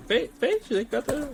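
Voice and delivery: high, odd put-on voice for the stranger; a stranger in the elevator noticing the jersey, high and awkward. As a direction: squeak out his surprise like an awkward fan. Imitating the guy in the elevator.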